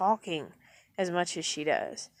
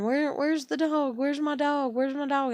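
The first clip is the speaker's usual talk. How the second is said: imitating hollering grandmother; 6 semitones higher than usual. Grandchild mimics grandmother calling for her lost dog, higher hollering. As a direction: play the worried old woman yelling across the yard. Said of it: "my grandmother starts hollering".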